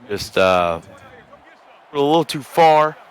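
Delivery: ordinary talk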